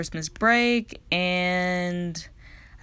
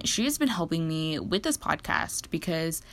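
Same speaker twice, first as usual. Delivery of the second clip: ordinary talk, nothing marked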